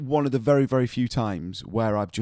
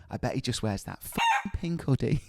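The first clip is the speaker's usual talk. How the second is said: put-on northern English accent, pitched up; northern mum; 2 semitones higher, 3 dB quieter than usual. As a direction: do a grumbling northern English mother. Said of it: "my mum is now really northern".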